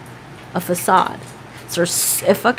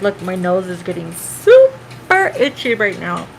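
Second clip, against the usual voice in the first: normal talking voice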